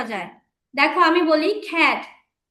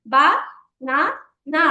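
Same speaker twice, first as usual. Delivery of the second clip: "বা", "না", "না" with a clear pause after each; three isolated beats, "বা না না"; syllable by syllable. Shown as a demonstration. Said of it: "আমরা তিন ভাগে উচ্চারণ করতে পারছি". ("বা না না" থেমে থেমে তিন ভাগে)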